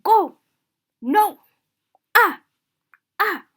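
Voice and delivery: loud "Go! No!" with sharp high falls; short, final, falling "Ah!"; scolding command. Shown as a demonstration. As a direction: bark "Go! No! Ah!" as orders. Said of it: "giving a command or you're scolding someone".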